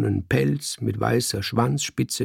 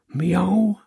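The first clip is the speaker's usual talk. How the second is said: high, drawn miaow; cat's meow; 7 semitones higher than usual. A narrator's reading. Cat answering the man's question, meowing. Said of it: "machte ich".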